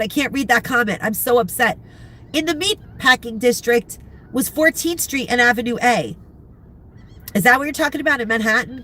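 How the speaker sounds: ordinary delivery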